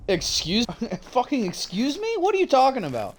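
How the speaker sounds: high, incredulous retold comeback; indignant disbelief. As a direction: throw the question back in mock outrage. Retold own angry retort.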